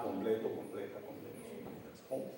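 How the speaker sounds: ordinary delivery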